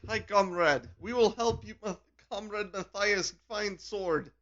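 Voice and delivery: hearty comic Russian comrade offering help; Russian accent. Retold or imitated. Shrew comrade offers Matthias help, mock Russian accent. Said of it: "I guess they really are Russian".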